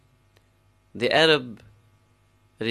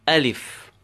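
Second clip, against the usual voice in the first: Alif with the A pressed, lif falling away; stress on first syllable; 3 semitones higher than usual. Shown as a demonstration. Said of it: "press the a and say alif".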